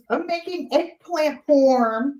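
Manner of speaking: high, slow Boston drawl; Boston accent. Retold or imitated. Phone chat about cooking dinner, imitated Boston accent. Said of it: "with her Boston accent".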